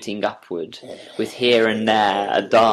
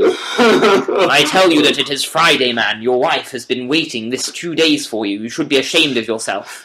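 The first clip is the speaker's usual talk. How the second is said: scolding; 3 semitones higher, 7 dB louder than usual. Acted. Watson scolding dazed friend.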